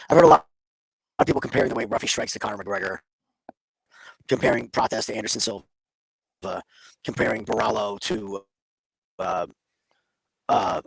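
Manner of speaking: glitchy distorted voice; robotic garbled audio; alien voice. Real, not acted. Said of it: "you absolutely sound like an alien".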